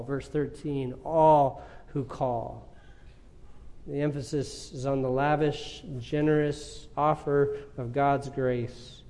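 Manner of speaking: normal talking voice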